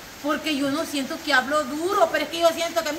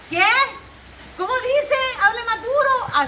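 mock hard of hearing; 9 semitones higher, 3 dB louder, slower than usual. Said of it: "me dicen así".

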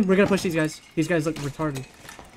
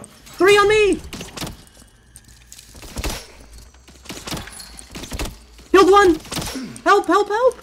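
urgent shouting; 13 semitones higher, slower than usual. Real, not acted. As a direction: yell it, outnumbered and desperate. Swarmed by enemies in fight.

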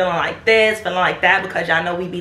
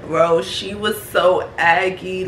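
usual speaking voice

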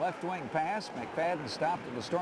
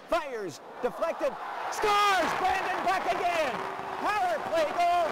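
high, loud scoring shout; long goal yell; 13 semitones higher, 4 dB louder than usual. Real, not acted. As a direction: belt out the goal. Goal call.

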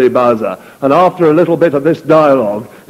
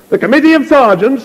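much higher, louder angry echo; raised voice; 9 semitones higher, 3 dB louder than usual. Acted. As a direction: fling it into the wind. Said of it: "he raised his voice".

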